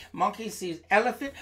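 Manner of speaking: usual speaking voice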